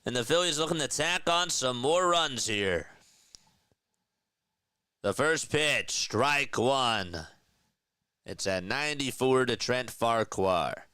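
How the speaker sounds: rolling announcer cadence with lifted pitch; live play-by-play. Real, not acted. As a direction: set up the at-bat like a sportscaster.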